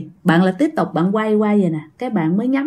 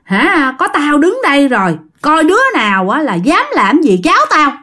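higher-pitched defiant challenge; retold tough talk, pitched up rather than shouted; scolding uncle; 6 semitones higher than usual. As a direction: imitate a protective uncle daring anyone to come near. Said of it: "Còn bác hai chửi".

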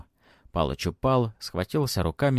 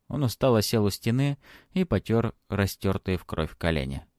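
ordinary delivery

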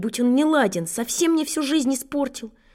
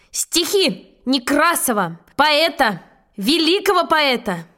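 slow, pompous poetry announcement with higher endings; loud announcement; 3 semitones higher, slower than usual. Acted. Schoolboy stalling before reciting to his teacher, in grand declamation. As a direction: stretch each word grandly, pausing between phrases. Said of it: "И громко начал".

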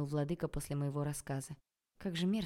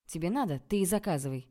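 ordinary delivery

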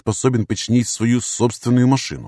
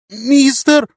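very high, pleading squeal; loud, piteous high-pitched cry; plaintive squeak; 7 dB louder than usual. A narrator's reading. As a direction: squeak it out pitifully. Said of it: "жалобно пискнула она".

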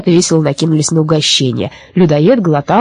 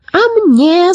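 cry out; 11 semitones higher than usual. A narrator's reading. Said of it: "закричал Абрахас".

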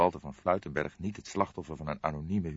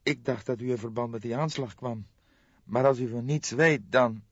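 put-on higher, tense young woman's voice; a shocked young woman breaking bad news; young woman Jolanda; 6 semitones higher, 4 dB louder than usual. Jolanda, helpless. (geschokte jonge vrouw, hogere stem)

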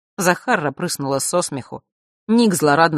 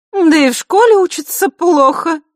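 loud haughty woman; 9 dB louder than usual. A narrator's reading. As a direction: announce it to the whole room. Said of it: "громкий голос Елены". (громкая надменная дама, говорит на весь зал)

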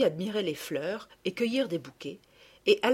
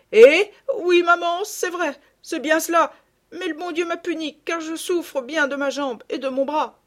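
put-on high, plaintive girl's confession; hurt child owning up, loud then easing; confessing daughter; 10 semitones higher, 9 dB louder than usual. A narrator's reading. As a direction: admit it pitifully to your mother.

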